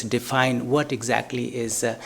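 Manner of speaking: ordinary talk, nothing marked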